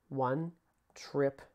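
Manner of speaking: "one triplet, three and four and" counted slowly; teacher's slow count; slow counting demo. Shown as a demonstration. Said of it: "That's how I usually count it".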